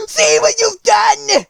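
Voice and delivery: high, slow witch scream; loud, horrified witch shriek. A narrator's reading. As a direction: shriek it in horror, drawing out the words. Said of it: "she screamed".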